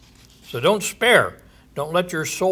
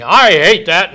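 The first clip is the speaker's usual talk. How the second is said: mock angry God; 3 semitones higher, 8 dB louder than usual. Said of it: "God saying".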